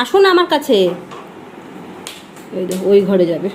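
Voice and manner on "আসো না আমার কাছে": coaxing call. Real, not acted. Calling baby to come.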